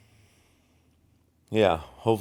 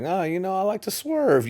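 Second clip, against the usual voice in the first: much higher, put-on voice for the politician bragging he likes to swerve; hammed-up high-pitched mimic of the politician; Trump impression; 13 semitones higher than usual. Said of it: "He's like".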